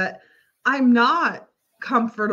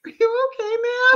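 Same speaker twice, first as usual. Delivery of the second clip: concerned knock. Retold or imitated.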